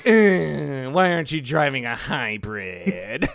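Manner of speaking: loud opening whine, sagging downward; droning, drawn-out robot nag; whiny robot powering down. Acted. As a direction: whine like a machine losing its charge. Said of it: "this just sounds more like a".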